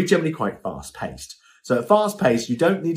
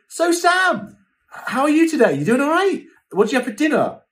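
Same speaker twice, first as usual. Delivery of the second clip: much higher chirpy host voice; imitating host; 13 semitones higher, 3 dB louder than usual. Host quizzing assistant Sam on air, higher and chirpy. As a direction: play the overeager host asking his assistant. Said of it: "then he'd be like".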